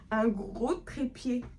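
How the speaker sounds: slow, drawn-out mock awe; drawn-out silly voice. Vlogger drawing out words about her tripod, slowly. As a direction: stretch each word like it's something grand. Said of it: "je parle comme ça de mon trépied".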